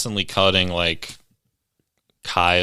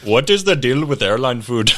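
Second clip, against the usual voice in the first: Indian accent impression; 4 semitones higher, 4 dB louder than usual. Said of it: "he had an Indian accent".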